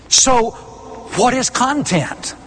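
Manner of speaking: ordinary talk, nothing marked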